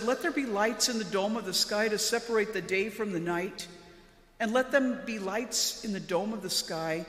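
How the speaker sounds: ordinary talk, nothing marked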